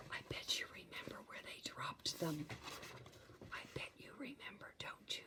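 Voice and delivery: conspiratorial whisper to the listener; whispered aside. Real, not acted. Reader confiding to child, soft whisper.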